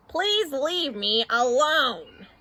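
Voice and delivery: slow, drawn-out request; exasperated plea. Acted. Bert begs Ernie for peace, slow and weary. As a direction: stretch out every word, fed up.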